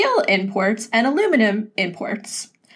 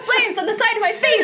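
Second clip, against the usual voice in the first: high, laughing mock rage from Clue; quoting the Clue gif; 12 semitones higher, faster than usual. Quoting Clue to friends, high mock fury through laughter. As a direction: squeal it out through laughter like the movie line. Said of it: "the gif where she's like".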